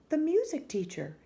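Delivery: usual speaking voice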